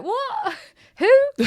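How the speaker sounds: high, loud shocked squeal. Retold or imitated. Retelling her shock at the psychic's words. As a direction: yelp it out in disbelief. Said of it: "I'm like".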